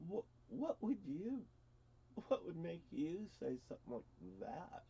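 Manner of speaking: subdued, halting question; stunned. Retold or imitated. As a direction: ask it quietly, taken aback. Re-enacts his stunned reply to sister.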